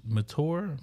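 Mature said with a hard T, "ma-TOOR"; alternative pronunciation. Shown as a demonstration. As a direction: ask Mature with a plain T, no CH sound. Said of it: "What is it?".

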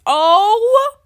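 loud "oh" sliding up high; mock happy crying. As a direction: wail it, hammed-up and overcome. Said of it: "I'm gonna cry".

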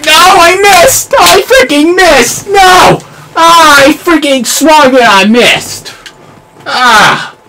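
high, loud wailing cry of frustration; real despairing shout; anguished yell. Real, not acted. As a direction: scream at your own miss. Missed swing in game.

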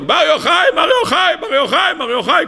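high, repeated possessed shouting; acted screaming. Possessed daughter of the emperor, acted screaming. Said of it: "והתחילה לצעוק".

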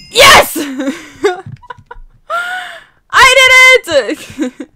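high, loud victory yell; slow, stretched shout of triumph; triumphant scream. Real, not acted. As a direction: cheer it like you won. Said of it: "sorry if I screamed".

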